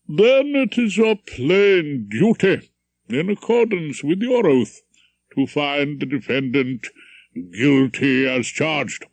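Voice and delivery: put-on pompous old judge addressing the jury; stern judge. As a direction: lecture the jury from the bench.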